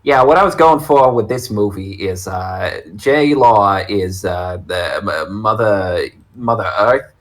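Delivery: put-on thick New York accent, director style; Aronofsky in a thick New York accent. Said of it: "has a thick New York accent".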